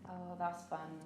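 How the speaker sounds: normal talking voice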